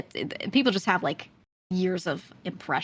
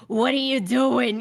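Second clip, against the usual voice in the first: slow, higher, imitated character voice; Tony's drawn-out question; 5 semitones higher, 4 dB louder, slower than usual. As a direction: stretch the question out playfully, imitating someone who just pulled up. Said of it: "he's like".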